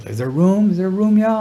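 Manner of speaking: mild imitation of a polite singer asking to join. Quoting [name] in the elevator.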